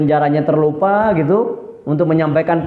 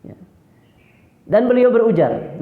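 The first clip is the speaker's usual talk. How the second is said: ordinary talk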